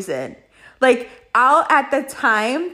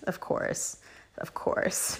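whispered, knowing aside; a knowing whispered of course; 14 dB quieter, slower than usual. Real, not acted. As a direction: breathe it out under your breath. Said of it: "repeated as an aside".

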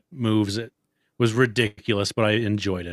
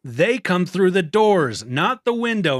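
high slow mock lecture; 9 semitones higher, 4 dB louder, slower than usual. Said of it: "He's like".

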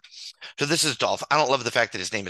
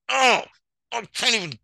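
much higher, louder strangled cry; so fed up the words break off; exasperated outburst; 12 semitones higher, 5 dB louder than usual. Real, not acted. Ranting to viewers about a lucky trade, a high exasperated outburst.

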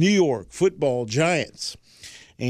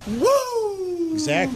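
Ric Flair woo; slower than usual. Retold or imitated. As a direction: let out a wild wrestling-promo woo. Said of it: "Can't leave out a Ric Flair woo".

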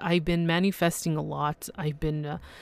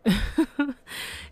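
giddy laugh; 7 semitones higher than usual. Real, not acted. Giddy over award news.

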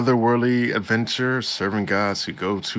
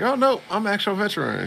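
put-on higher, quick boyish protest; insisting he's no child, pitched up; protesting kid; 12 semitones higher, faster than usual. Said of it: "he's like".

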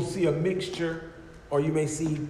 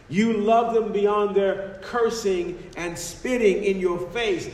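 full-force preaching; 3 semitones higher than usual. Acted.

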